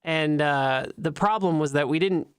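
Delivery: ordinary talk, nothing marked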